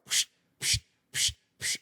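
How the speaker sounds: soft hushed psh, spaced out; repeated slide-click noise. Retold or imitated. Storyteller showing the same picture flashing back, hushed psh sounds. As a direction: mimic a slide projector clicking over and over.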